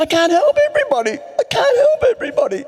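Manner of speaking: high, fast anguished wail; a frantic, high-pitched plea; despairing cry. Retold or imitated. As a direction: cry out that it's all too much. Wally's breakdown in the car.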